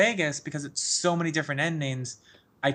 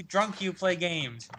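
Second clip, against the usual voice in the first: usual speaking voice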